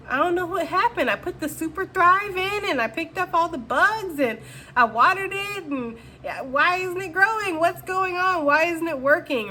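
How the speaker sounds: high, exasperated mock whine of bewilderment; baffled complaint. Reader voicing the client puzzled over a plant not growing, high and exasperated. Acts out the frustrated listener.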